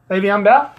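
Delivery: Le'Veon Bell? again rising in disbelief; doubting question. Shown as a demonstration.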